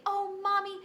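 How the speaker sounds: high, gushing thank-you; thrilled, grateful child. Pretend. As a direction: act like a child overjoyed with a present. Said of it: "you might say".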